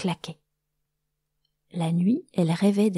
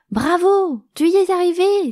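much higher and louder cheering cry; joyful exclaim; 10 semitones higher, 7 dB louder than usual. Acted. Cricket cheers Brise when the feather flies, joyful shout. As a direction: cheer her success with delight. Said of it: "s'exclama le criquet".

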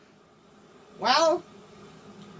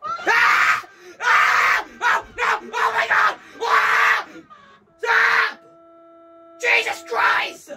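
high, loud panicked screams; terrified screaming; 11 semitones higher, 8 dB louder, slower than usual. Real, not acted. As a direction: scream in real terror. Screaming at horror game.

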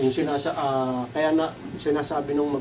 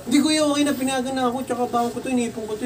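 put-on kid pleading; 7 semitones higher than usual. Kid insisting voiced.

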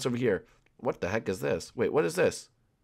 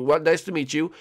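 ordinary delivery

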